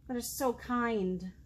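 plain reading voice

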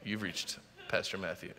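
low, soft mock voicemail greeting; special voicemail voice. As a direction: play the voicemail recording, low and hushed. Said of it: "I use my special voice".

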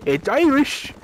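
high, hammed-up Irish accent. Mocking the video's barman, exaggerated Irish accent. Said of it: "in the most stereotypical Irish".

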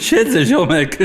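words through hysterical laughter; stoned friend laughing while saying he sits; laughing hysterically. Acted. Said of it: "śmiejąc się histerycznie".

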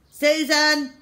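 high, loud nurse's summons; calling out. A narrator's reading. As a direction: call the next patient sharply. Nurse calls next patient.

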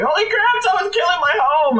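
high frantic squeal for the enemy; panicked creature voice. Retold or imitated. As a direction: voice the monster freaking out, very high. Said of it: "he's like".

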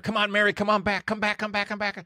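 high, urgent calls to come back; rescuers yelling. Retold or imitated. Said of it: "And they're yelling at her".

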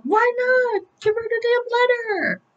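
very high-pitched acted protest; exasperated urging. [name] urges Spencer to hand over letter, exasperated. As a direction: plead in a high, worked-up voice.

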